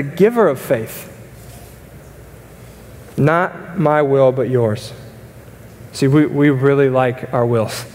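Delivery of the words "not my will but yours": slow, drawn-out quote of surrender. Acted. Preacher quoting Jesus' prayer, slow and yielding. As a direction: let each word of surrender land slowly.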